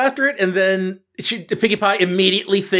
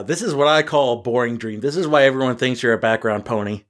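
mock shade; 7 semitones lower, faster than usual. Mock insult, low fast.

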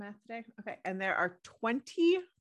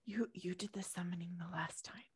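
whisper; 12 dB quieter than usual. Acted. Whispering before summoning ghosts.

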